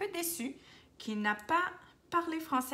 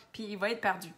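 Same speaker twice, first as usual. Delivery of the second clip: ordinary delivery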